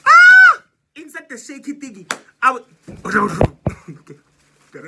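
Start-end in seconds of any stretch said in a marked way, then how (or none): 0.1-0.6 loud high shriek of shock; sudden scream of disbelief. Retold or imitated. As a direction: let out a piercing scream. Shriek at dad's reply.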